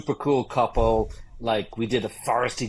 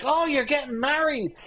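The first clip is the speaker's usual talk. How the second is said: much higher, mock drunk voice; drunk golfer; 13 semitones higher than usual. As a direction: play the sloppy drunk catching on. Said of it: "then he's like".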